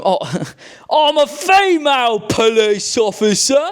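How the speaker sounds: mock female officer. Reviewer lightly mimicking the girl beside him, with a laugh. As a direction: play her half-laughing. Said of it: "like, the girl next to him".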